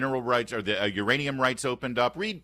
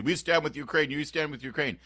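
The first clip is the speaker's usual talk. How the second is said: mocking quote; 6 semitones higher than usual. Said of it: "virtue signaling tweets".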